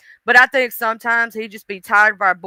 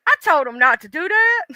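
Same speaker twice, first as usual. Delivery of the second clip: higher, mock-indignant complaint; exasperated God; 6 semitones higher than usual. God complaining to Jesus about a human, mock indignation. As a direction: protest it high, half amused. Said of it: "He be like".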